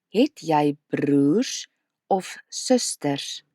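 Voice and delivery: slow question, "Het" rising and "of susters" falling; teacher modelling slowly; slow model question. Shown as a demonstration. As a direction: lay it out slowly to be copied. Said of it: "Say it with me".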